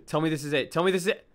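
usual speaking voice

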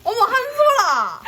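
high, loud, drawn-out cry of recognition; delighted exclamation. Real, not acted. Friend spotting a familiar artist's style in her gift, delighted exclamation. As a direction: exclaim it high and long, thrilled.

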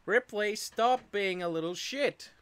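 scolding the cat. Real, not acted. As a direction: tell the cat off, slowly.

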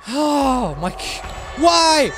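high, very loud outcry of shock; startled scream. Real, not acted. Player scared by boo sound effect, real startled yelling. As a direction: cry out as if jumped.

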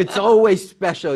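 slow, loud, high New York drawl; hammed-up New York accent line. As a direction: lean hard into a thick New York accent. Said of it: "You're doing that accent".

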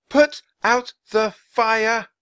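high, loud, slow command; drawn-out girl's shout, word by word; yelled. A narrator's reading. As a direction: yell each word as a spell. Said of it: "she yelled".

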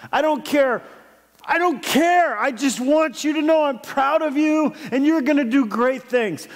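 high, emphatic, heartfelt insistence; insistent reassurance. Retold or imitated. Father tells his golfing son he's proud, insistent and high.

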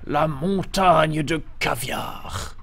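slow, drawn-out whisper; hushed, savouring stage whisper. Pretend. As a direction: whisper it slowly, word by word. Game banter, whispered silly phrase.

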